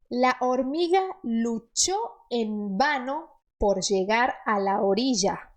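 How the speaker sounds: careful Spanish reading; Spanish model reading; reading aloud in Spanish. Acted. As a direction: read the sentence to the learners. Reads Spanish sentence.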